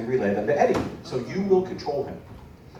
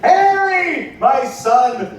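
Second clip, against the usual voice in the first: dramatic shout; 13 semitones higher, 8 dB louder, much slower than usual. Acted.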